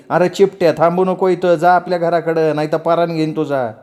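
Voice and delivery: high-pitched scolding, volume held in; aunt's angry yelling. Acted. Aunt orders the young man out and rounds on the girl, angrily. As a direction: scold sharply as an outraged aunt. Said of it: "चाची ओरडली".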